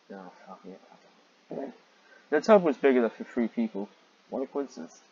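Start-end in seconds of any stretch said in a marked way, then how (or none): 0.1-1.7 soft muttered surrender, trailing into a whisper; giving up. Real, not acted. Reader giving up on dragon washing, muttering. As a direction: let it go under your breath.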